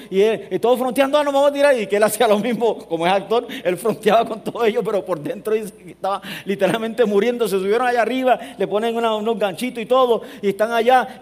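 high, loud, building sermon story; full-force preaching. Acted. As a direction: drive the tale home to the congregation.

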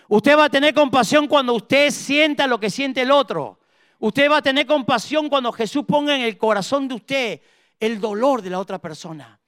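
high-pitched, emphatic preacher's delivery; forceful preaching. Acted. Preacher telling the congregation when compassion comes, forceful preaching. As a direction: preach it with rising fervour.

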